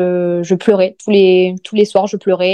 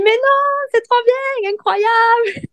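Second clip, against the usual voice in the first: much higher, bubbling with laughter; laughing delight; 13 semitones higher, much slower than usual. Retold or imitated. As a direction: squeal it out through a laugh like the delighted sister. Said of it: "elle explose de rire".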